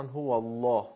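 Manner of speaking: الله with a thick, heavy L; teacher's demo of emphatic L in الله; heavy lam. Shown as a demonstration. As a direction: make the double L in الله full and dark.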